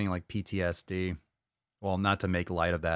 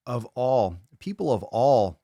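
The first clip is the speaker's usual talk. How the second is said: loud, stressed, slower; loud emphasis; 4 semitones higher, 6 dB louder, slower than usual. Real, not acted. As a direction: punch it out loudly.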